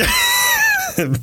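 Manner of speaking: sudden high shrieking laugh. Real, not acted. Burst at PCP drug joke.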